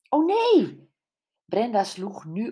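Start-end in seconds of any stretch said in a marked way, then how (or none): 0.1-0.9 high, loud cry of alarm; jolted, a sudden high "oh no"; shocked gasp. Acted. Brenda learns the friends are missing, shocked high cry.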